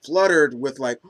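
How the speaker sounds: ordinary delivery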